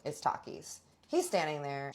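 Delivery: normal talking voice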